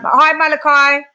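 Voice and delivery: calling out a greeting. Real, not acted. Greeting a viewer in the live chat.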